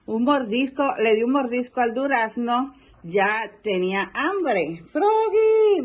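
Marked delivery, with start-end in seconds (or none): 4.9-5.8 high, loud call. Acted. Dad calling Froggy yet again, loud high call. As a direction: holler his name once more.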